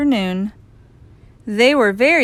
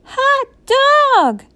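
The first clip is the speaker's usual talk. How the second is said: put-on high, loud children's cheer; gleeful kid shout; excited cry; 6 dB louder than usual. A narrator's reading. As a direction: cheer like a jubilant class. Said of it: "cried the class".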